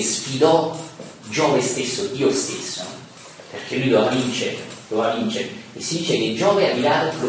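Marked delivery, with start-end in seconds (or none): none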